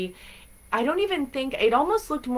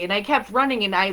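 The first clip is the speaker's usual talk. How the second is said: ordinary delivery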